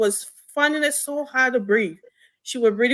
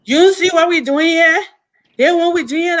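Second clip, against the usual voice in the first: loud, raised-pitch angry retort; going off angrily; 5 semitones higher, 8 dB louder than usual. Retold or imitated. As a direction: imitate a man lashing out, much louder and higher. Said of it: "The guy went off on me".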